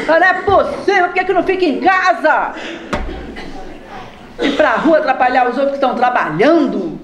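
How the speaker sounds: high, laughing mock complaint; mock irritation. Preacher imitating someone annoyed at an old man, high and laughing. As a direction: grumble as if blocked by an old man.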